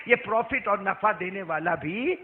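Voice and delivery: usual speaking voice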